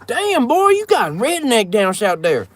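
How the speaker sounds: high whooping hillbilly yell. Pretend. Mock redneck whoop.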